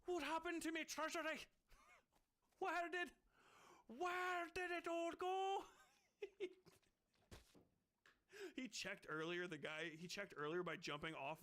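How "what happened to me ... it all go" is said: much higher cartoon duck voice, slowing on the last words; Scrooge McDuck. Host doing a high cartoon duck, mourning the empty treasury. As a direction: wail like a miser who lost his gold. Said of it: "Scrooge McDuck".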